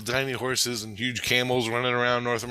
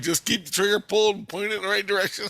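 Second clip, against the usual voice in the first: excited instruction; 9 semitones higher, faster than usual. Retold or imitated. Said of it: "I'm like".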